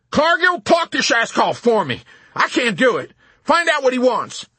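high, loud, frantic plea; panicked general yelling for rescue; desperate. A narrator's reading. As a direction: beg a subordinate to take over. Panicking general begs colonel for help.

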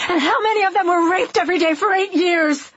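high-pitched, anguished outburst; yelling. A narrator's reading. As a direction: cry out the accusation in fury. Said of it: "I yelled".

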